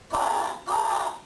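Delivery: two harsh breathy caws; raven caw. Acted. Raven caws, puzzling over the medicine dance. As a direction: caw twice like a restless raven.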